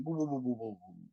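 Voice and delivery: low motor noise dying away; imitated engine sputter. Imitates jet ski motor stopping.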